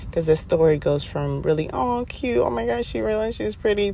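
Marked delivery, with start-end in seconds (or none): 1.7-3.9 high, airy cooing over something cute; playfully sweet, girlish swoon; mock gushing. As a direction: coo with delight, pitched up. Acts out gushing first reaction to herself.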